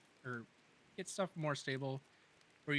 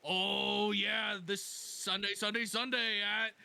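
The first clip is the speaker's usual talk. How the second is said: slow booming rally hype; monster truck ad; 6 semitones higher, slower than usual. Acted. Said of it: "Monster Truck Rally".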